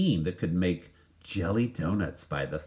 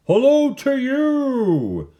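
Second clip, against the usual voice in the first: high, loud, slow answering shout; shouting back; 7 dB louder, much slower than usual. Acted. Woodcutters shouting back up to Walter, loud high call. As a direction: call up cheerfully from below. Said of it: "they shouted back".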